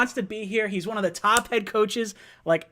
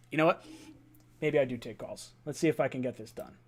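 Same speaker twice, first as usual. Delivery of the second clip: ordinary delivery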